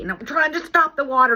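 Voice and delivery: mock panic; frantic. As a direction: rush it like chaos. Frantic flood story.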